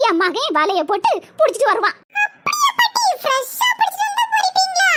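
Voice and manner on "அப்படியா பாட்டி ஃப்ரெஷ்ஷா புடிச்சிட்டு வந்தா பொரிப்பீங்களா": high-pitched wide-eyed wonder; amazed child. Acted. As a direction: ask in eager, high amazement. Child amazed at fresh fish. (வியப்பான குழந்தைக் குரல்)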